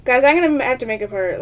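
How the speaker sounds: ordinary talk, nothing marked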